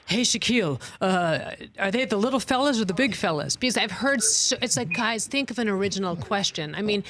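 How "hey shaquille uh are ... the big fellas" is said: mock TV anchor, lightly put on; fake anchor voice. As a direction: ask it like a cheesy sportscaster. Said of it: "in the fake anchor voice".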